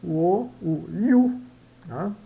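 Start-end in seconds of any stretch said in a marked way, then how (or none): 0.9-1.4 "You" high and loud, the Y like an I, "iu"; teacher modelling "You"; pronunciation demo. Shown as a demonstration. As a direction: lift "You" and start it with an ee glide. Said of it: "Y soa I".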